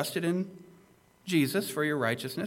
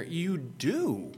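"you do" stressed, pitched higher; raised, insistent "you do"; emphatic; 4 semitones higher than usual. Shown as a demonstration. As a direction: lean on "you do" as a settled fact. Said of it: "but I'm emphasizing here".

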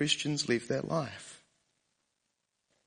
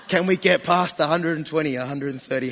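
louder, eager, revving up the question; playful excitement; 7 dB louder than usual. Pretend. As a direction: sound keen to floor the minivan. Imagined speeding in the minivan.

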